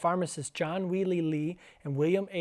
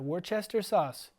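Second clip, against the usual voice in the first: ordinary talk, nothing marked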